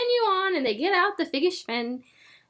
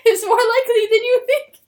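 loud, laughing meme delivery; hammed-up meme voice, louder; meme line; 10 semitones higher, 9 dB louder than usual. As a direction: quote the old meme, cracking up. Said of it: "but, like".

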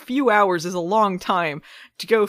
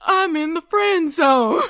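hammed-up high wail; 10 semitones higher, 7 dB louder, slower than usual. Joins the Jordan bit.

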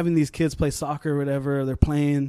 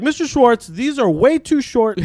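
higher, drawn-out tattling whine; mock complaint; 8 semitones higher, 5 dB louder than usual. Student tattling to Mister Schwartz, mock complaint. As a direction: play the scandalized tattletale.

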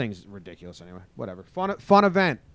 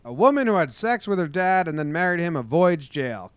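news read; 6 semitones higher, 5 dB louder than usual. Acted. Rereads headline.

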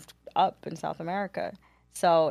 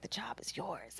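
whispering; 2 semitones higher, 17 dB quieter than usual. Retold or imitated. Quoting VP's job offer.

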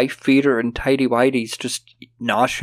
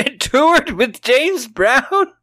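high, slow, disbelieving laugh-question; incredulous; 11 semitones higher, much slower than usual. Real, not acted. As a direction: stretch it out in disbelief. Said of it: "incredulous reaction".

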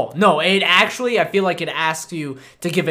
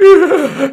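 loud, high gasp of release; big acted gasp; 9 semitones higher, 8 dB louder than usual. Co-host showing stress leaving the body, big gasp. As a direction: gasp as if breathing for the first time. Acts out first breath after stress.

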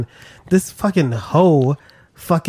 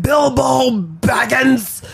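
slow, drawn-out wizard call; booming Gandalf voice; 9 semitones higher, much slower than usual. Retold or imitated. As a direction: sing out the name like a grand wizard. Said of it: "he's like".

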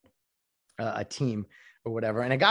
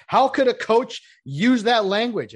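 mock outrage; 10 semitones higher, 7 dB louder, slower than usual. Mocking media's outrage.

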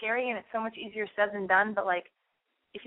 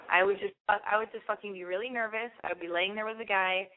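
ordinary talk

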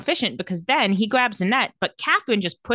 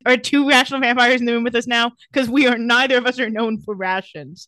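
louder, put-on mocking voice; sarcastic jab; 3 semitones higher, 4 dB louder than usual. Recapper mocking the rational vampires line, loud and sarcastic. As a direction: poke fun at the idea.

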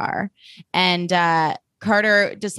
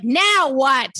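higher, exasperated mock demand; annoyed; 5 semitones higher than usual. Host imitates Blair turning around, annoyed. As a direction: put on a fed-up retort. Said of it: "She's, like, so annoyed".

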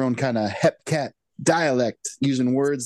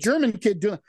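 ordinary delivery

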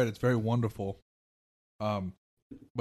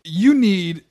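rousing speech; 6 semitones higher, 7 dB louder than usual. Pretend. Rallying speech to America.

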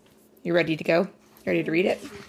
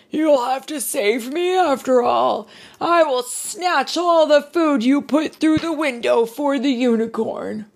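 higher and louder, cocky giant voice; gloating giant; 9 semitones higher, 5 dB louder than usual. Acted. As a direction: tease Noah from the unicorn's back. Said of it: "he cried with a huge chuckle".

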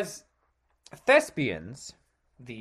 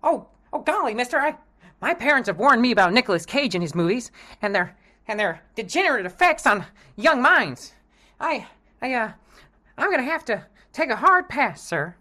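put-on high, eager gee-whiz boy; wholesome 1950s kid; 8 semitones higher, 5 dB louder, faster than usual. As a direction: innocent golly-gee schoolboy voice. Kid refuses dealer.

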